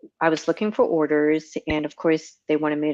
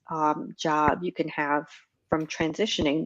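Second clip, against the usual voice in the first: ordinary talk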